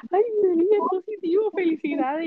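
high excited coworker voice. Retold or imitated. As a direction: pitch it way up, bubbling with news. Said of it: "y ella".

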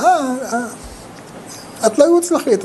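high, drawn-out sneering "אה, אה"; mocking put-down. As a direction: mimic a scornful nag, high and slow. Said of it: "אמרו לה". (לגלוג מתנשא בקול גבוה)

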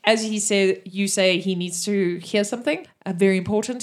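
ordinary delivery

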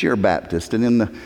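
usual speaking voice

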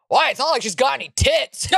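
mock protesting dad; imitated dad. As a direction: imitate a father arguing back in disbelief. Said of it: "And my dad goes".

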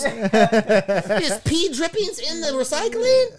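high, slow, laughing disbelief. Real, not acted. Dad learning the pee went in the recycling, high laughing disbelief. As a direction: stretch it out in laughing shock.

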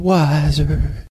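slow, drawn out after a swallow; savoring the beer name after a sip; while sipping a drink. Real, not acted. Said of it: "sip of my Budweiser".

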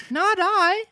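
put-on squeaky small boy answering the police; puppet boy. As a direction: Pinocchio answers the officers in a high boy voice. Pinocchio voice.

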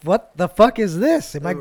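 loud, high, disbelieving questions; incredulous outrage. Retold or imitated. Employee confronted by two unknown managers over a write-up, incredulous. Retold disbelief at being written up.

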